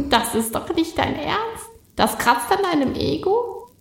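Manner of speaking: high, incredulous teasing; mock him like a cheeky sprite; mocking spirit voice. Spirit mocks Cornelius.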